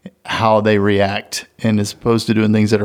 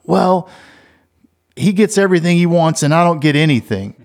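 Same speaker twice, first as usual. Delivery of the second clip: put-on higher, whiny child complaining; whiny kid; 7 semitones higher than usual. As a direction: play the sulky kid who feels cheated.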